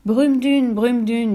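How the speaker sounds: loud, high, ringing spell; resounding magical recitation; swelling incantation. A narrator's reading. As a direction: project the chant across the deck. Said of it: "Sa voix s'amplifia et résonna".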